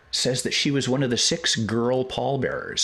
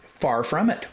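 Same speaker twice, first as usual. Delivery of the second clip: Far from it sung out higher with a rising end; mock TV pitchman; 7 semitones higher than usual. Shown as a demonstration. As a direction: hype it like a late-night ad. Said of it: "The Ronco tape case".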